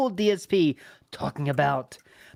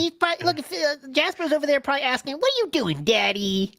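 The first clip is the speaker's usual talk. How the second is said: high mocking baby-talk voice, much higher; mocking Phil; 8 semitones higher than usual. Mocking a streamer cooing at his cat, high and cutesy. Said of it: "When he says".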